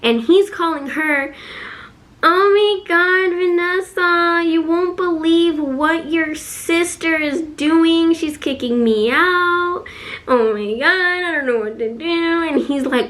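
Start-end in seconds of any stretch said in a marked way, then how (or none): 2.2-12.4 high, drawn-out whiny wail; hammed-up tearful pleading, dragged out; mock crying. Father crying on the phone to her little sister, hammed-up mock wailing. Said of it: "crying to her".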